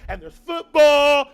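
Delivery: the big finish of the list, crowd-pleasing; drawn-out hype on "football"; excited shout. Real, not acted. Listing Thanksgiving joys.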